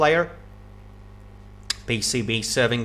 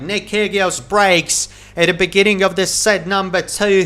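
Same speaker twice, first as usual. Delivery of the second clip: louder and higher, rising to the break; excited break call; 5 semitones higher, 4 dB louder than usual. Real, not acted. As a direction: build up as the break lands. Commentator calls the break.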